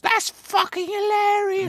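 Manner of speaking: very high, drawn-out Essex amazement; Essex accent. Retold or imitated. Essex local delighted by American speech, high slow Essex accent. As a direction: gush in a squeaky mock Essex accent. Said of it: "everybody talk like this".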